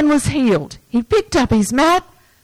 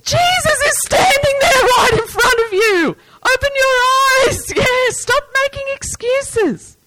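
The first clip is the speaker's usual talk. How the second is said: yelling; 13 semitones higher than usual. Retold or imitated. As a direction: yell at the screen to wake him up. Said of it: "you would be yelling".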